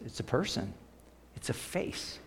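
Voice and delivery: whispered reverent point; hushed. Acted. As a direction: drop to an intimate hush.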